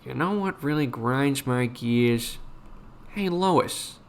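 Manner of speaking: slow cartoon dad impression; Peter Griffin. Streamer attempting a requested cartoon impression for chat, lightly voiced cartoon dad. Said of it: "Can you just do a Peter Griffin already?".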